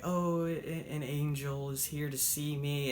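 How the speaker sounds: put-on soft, faint voice of a dying old woman; frail dying old woman. Dying old woman greets Diana as an angel, faint and frail. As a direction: barely breathe the words out from a deathbed. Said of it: "the old woman's just like".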